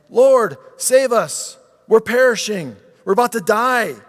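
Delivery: high, loud, slowed cry for rescue; panicked plea. Acted. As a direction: cry out as if the boat is sinking. Disciples wake Jesus in panic.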